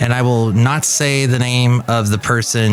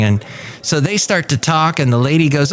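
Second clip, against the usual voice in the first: ordinary talk, nothing marked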